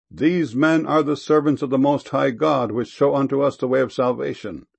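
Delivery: ordinary delivery, nothing marked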